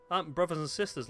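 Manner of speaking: normal talking voice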